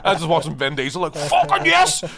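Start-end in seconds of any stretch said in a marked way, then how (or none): 1.2-2.0 very high, loud yell of triumph; hammed-up cheer. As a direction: yell it like an overexcited film fan. Acts out cheering at Vin Diesel.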